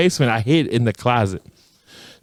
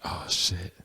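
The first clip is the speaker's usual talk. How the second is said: whispered oh shit; 6 semitones lower, 13 dB quieter than usual. Retold or imitated. Retold reaction to crowd.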